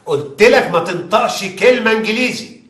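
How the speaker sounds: angry scolding. Acted. Investigator scolding suspect.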